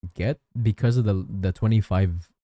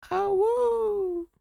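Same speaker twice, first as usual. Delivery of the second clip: long high "awoo" howl; wolf howl; 3 dB quieter than usual. Pretend. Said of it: "we have an awoo emote".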